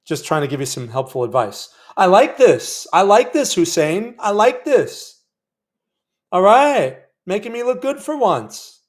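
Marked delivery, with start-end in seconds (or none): 2.0-5.2 loud, delighted cheer; excited. Real, not acted. Trader cheering his winning trade to Hussein, loud and excited. 6.3-8.8 pleased. Real, not acted. Trade going his way.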